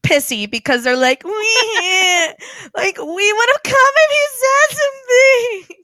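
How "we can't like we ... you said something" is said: high whiny mock-crying Death Eaters; hammed-up sniveling excuses, laughing; Death Eater impression. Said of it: "That's my Death Eater impression".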